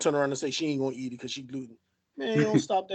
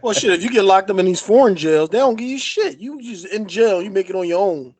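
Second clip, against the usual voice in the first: loud rant; 4 semitones higher, 7 dB louder than usual. Real, not acted. Heated rant about foreign jails.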